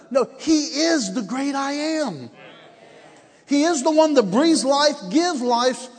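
high, drawn-out proclamation; full-force preaching. Real, not acted. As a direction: proclaim it to the congregation, stretching the words.

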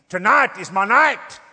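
loud, slow cry out; determined shout. Retold or imitated. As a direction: shout it slowly. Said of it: "shouting at God".

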